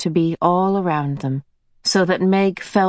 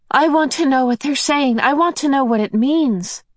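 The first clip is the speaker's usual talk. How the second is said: put-on high, eager girl's voice; a girl longing to understand; young girl voice; 7 semitones higher than usual. A narrator's reading. Girl Meg pleads to know.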